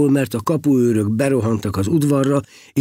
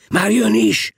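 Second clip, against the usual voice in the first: high, raised cry of alert; shouted warning; 10 semitones higher, 4 dB louder than usual. A narrator's reading. A monk warning that the abbot comes, shouting. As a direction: call it out sharply to everyone. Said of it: "kiáltotta valaki".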